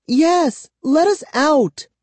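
high-pitched, urgent insistence; insistent demand. A narrator's reading. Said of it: "Kara insisted".

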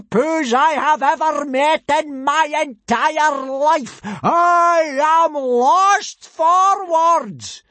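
high, loud, slow, exasperated abuse; howling tirade. A narrator's reading. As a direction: howl the insults, building to a stretched-out finish. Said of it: "howled Gobber".

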